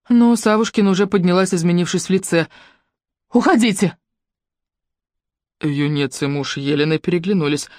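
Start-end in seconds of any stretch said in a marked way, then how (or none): 3.3-4.0 angry order. A narrator's reading. Lyubka orders the visitors out, raised voice. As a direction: drive them out.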